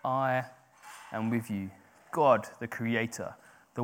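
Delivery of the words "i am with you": quiet promise. Acted. God's promise repeated gently.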